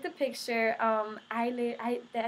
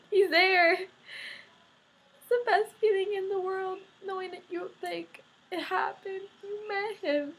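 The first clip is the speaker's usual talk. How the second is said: real happy crying, voice pitched high; tearful overwhelmed joy; 7 semitones higher than usual. Real, not acted. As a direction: choke up with joy at a dream come true. Overwhelmed, recalling meeting her idol.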